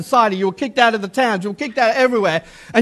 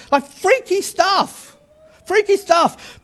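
spooked wonder; 7 semitones higher, slower than usual. Acted. Marvelling at the baptism.

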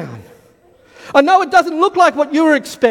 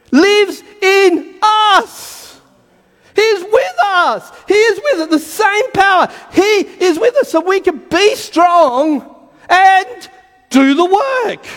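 preaching shout; 6 semitones higher, slower than usual. Real, not acted. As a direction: proclaim it with everything. Preacher building to climax.

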